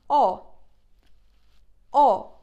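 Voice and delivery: a loud round "O" as in corner; Turkish o demo. Shown as a demonstration. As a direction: hold a clean round "O". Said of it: "O, we say".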